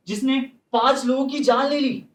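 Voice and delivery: ordinary delivery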